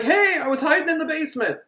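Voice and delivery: high, chirpy surprise announcement; mock-cheery intruder; comic pop-up greeting. Korvik pops up, retold.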